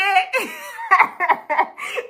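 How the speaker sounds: long high-pitched laugh that rises; high shrieking laugh. Real, not acted. Cracking up at her own Jesus story, high shrieking laugh.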